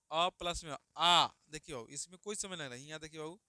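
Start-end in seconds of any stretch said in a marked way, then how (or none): 0.1-1.3 long drawn-out आ, slow and loud; stretched vowel demo. Shown as a demonstration. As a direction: drag the आ out to show its double length. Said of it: "इसमें दुगुना समय". (आ को खींचकर लंबा बोलना)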